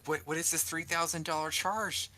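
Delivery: high-pitched mock interrogation; incredulous questioning. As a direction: imitate a baffled host demanding an explanation. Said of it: "he'll be like".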